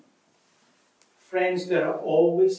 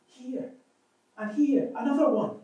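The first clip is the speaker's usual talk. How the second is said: mild wail; 7 semitones higher than usual. Acted. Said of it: "Corrie wailed".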